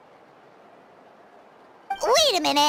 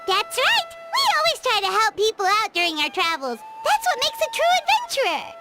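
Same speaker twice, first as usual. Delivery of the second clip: proud, excited. Acted. Companion declaring what makes a true adventurer, excited. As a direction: chirp it brightly, bursting with pride.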